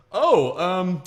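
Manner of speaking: high, slow, halting dad covering up; caught-out dad. Acted. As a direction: stall for an excuse as a caught father. Caught father voiced in joke.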